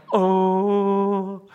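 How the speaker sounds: long, held collective "oh"; crowd's reaction. Acted. Crowd reacting to Jesus's clever answer, drawn-out ooh. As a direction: draw it out on one level pitch, like an impressed crowd.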